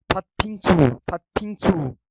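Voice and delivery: फत्थिंगसू with the त्थ held and pressed; pressed consonants. Shown as a demonstration. Teacher showing students a pressed sound. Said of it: "दबा के बोलना है".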